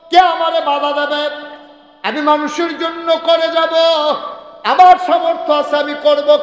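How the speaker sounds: high, loud chanted vows; defiant preaching at full force. Acted. Preacher voices a believer vowing fasting and pilgrimage, defiant chanted preaching. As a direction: hurl each vow out, ringing and high.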